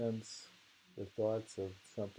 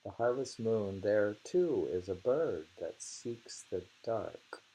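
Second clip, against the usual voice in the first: poem reading; 4 dB louder than usual. Shown as a demonstration. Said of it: "reads haiku".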